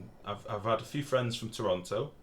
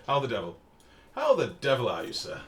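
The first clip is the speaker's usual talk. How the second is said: louder, hammy upper-class Englishman; posh English accent; 7 dB louder than usual. Pretend. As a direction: greet like a stuffy English gent. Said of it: "Hundred percent English".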